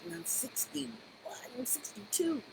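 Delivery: a quick whispered joke to herself; whispered aside. Real, not acted. Speaker jokes about her age to viewers, whispered. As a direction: drop to a whisper, half-laughing at her own age.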